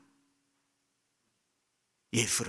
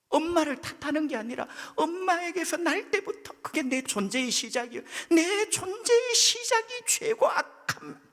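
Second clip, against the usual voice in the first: 1 impassioned high preaching; 12 semitones higher, 3 dB louder than usual. Real, not acted. Preacher pleading at high pitch.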